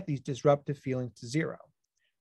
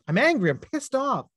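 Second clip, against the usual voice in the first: mock-angry outburst, higher and louder; retold anger; 9 semitones higher, 4 dB louder than usual. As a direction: snap it back as he did at his wife, higher and louder. Retold answer to his wife.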